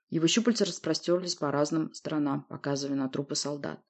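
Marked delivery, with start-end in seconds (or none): none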